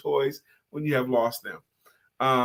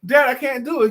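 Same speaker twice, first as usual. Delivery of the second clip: put-on higher, louder strained child; straining boy; 9 semitones higher, 5 dB louder than usual. Boy struggling with the toy box, strained and high. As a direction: push and fail, like a kid. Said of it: "and he can't move it".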